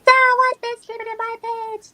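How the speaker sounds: squeaky, very high chipmunk voice. Pretend. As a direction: pitch it way up like a cartoon chipmunk. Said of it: "can I do my chipmunk voice?".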